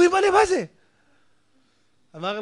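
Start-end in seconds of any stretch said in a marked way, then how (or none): 0.0-0.6 frightened cry. Acted. People feel the earthquake.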